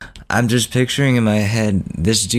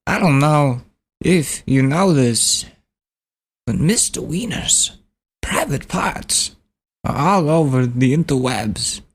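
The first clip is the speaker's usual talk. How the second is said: Christopher Walken; 5 semitones higher than usual. Retold or imitated. Prank caller to campaign staffer, celebrity impression. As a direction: stretch each phrase out, high and oddly halting. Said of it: "Christopher Walken".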